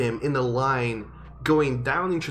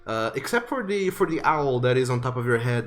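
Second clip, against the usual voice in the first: usual speaking voice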